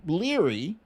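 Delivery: ordinary delivery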